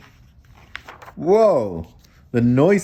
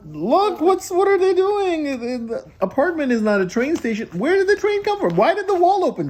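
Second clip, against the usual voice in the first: bewildered. Acted. Reader acts baffled at the picture.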